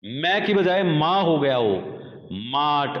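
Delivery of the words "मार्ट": मार्ट said as a long maa with no r; non-rhotic मार्ट with a long father-like a; silent r, long a. Shown as a demonstration. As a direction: stretch the a in मार्ट and drop the r. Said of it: "यहां पर आर बिल्कुल खत्म हो जाता है".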